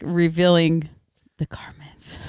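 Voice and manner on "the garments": hushed scandalized whisper. Acted. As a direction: breathe it like a secret. Joking about revealing LDS garments.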